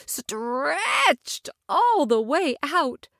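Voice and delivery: slow, stretched-out delivery; drawn out. A narrator's reading. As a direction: stretch the words long. Narration stretching word.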